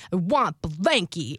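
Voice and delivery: low, slow, drawn-out demand; imitated demanding child. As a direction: drag it out like a stubborn kid. Child's repeated demand, retold.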